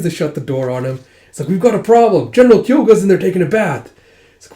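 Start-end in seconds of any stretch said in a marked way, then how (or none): 1.5-3.9 high, loud, fast alarm; panicked report. Retold or imitated. Dragon reporting the general in the bath, panicked. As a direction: rush it out in alarm.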